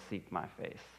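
hushed whisper of God's call; a soft whispered invitation. Acted. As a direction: breathe it, voiceless and intimate.

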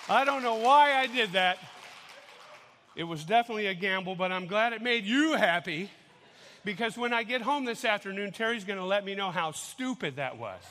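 loud, winded and fast after dancing; gasping the words out after a burst of dancing; loud, breathless rush of words; out of breath. Real, not acted. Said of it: "Whew, I'm out of breath".

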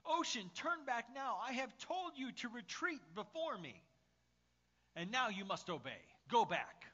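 theatrical forceful shouting; shouted royal command. Acted. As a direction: berate the sea like an outraged king. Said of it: "screaming at the ocean to stop".

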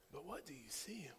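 whispered, pressing question; intimate whisper. Acted. Pastor whispering a challenge to the godfathers. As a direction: lean in and whisper it to them.

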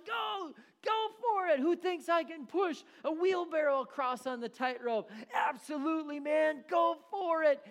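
high, loud yelling; hammed-up loudmouth cheering; shouting crowd. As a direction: bellow it from the front row. Loudmouth cheering, walker calling.

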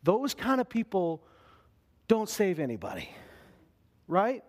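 normal talking voice

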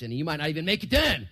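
ordinary talk

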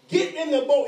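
Jesus barking a high, loud order; stern command. Retold or imitated. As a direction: order them into the boat, no arguing. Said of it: "It's like a stern command".

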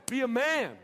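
loud, high-pitched mock coach yell. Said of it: "But he'd be yelling at us".

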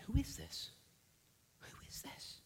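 hushed, fading to a whisper; awed whisper. Retold or imitated. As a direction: breathe it out in awe. Disciples awed after storm.

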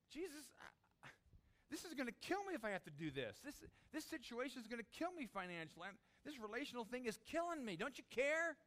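high, strained desperate pleading; desperate plea. Acted. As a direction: beg like it is killing you.

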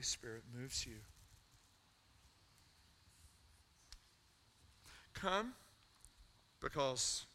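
earnest pastoral invitation, building over music; altar call. Real, not acted. Pastor inviting the congregation forward, earnest and building. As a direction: call them forward gently, then rise.

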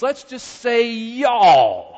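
slow, loud "Let's just say y'all"; emphatic y'all. Shown as a demonstration. Preacher urging the congregation, slow and loud on "y'all". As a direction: draw out "y'all" with weight. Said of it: "let's just go ahead and say".

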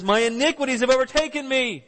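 high-pitched, distressed recital; anguished prayer. Acted. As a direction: cry out like someone overwhelmed. David's desperate prayer acted out.

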